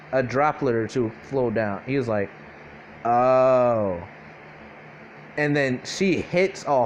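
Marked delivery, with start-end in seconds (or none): 3.0-4.0 long, drawn-out realizing oh; acted dawning realization. Deku as it clicks to him, long realizing oh. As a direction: let the realization stretch out.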